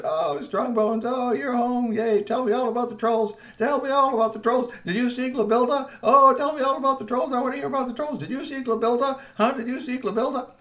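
put-on high, eager little child voice pestering; excited little kid. Little sister begging her brother for troll stories, high and eager. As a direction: beg like an excited small child. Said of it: "Cute Bones came down and grabbed him".

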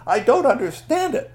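high, loud storybook scolding; loud, baffled complaint from a story character; indignant Pakwatchi. Acted. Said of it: "Pakwatchi comes in and says".

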